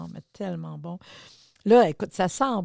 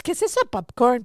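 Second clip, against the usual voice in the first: surprised; 5 semitones higher, 7 dB louder than usual. Retold or imitated. Surprised at popcorn stand.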